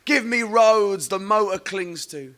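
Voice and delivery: slow, high, drawn-out poetry reading; poem recital. Acted.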